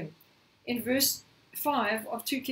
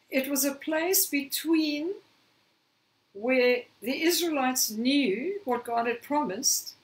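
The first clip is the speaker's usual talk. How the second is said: ordinary delivery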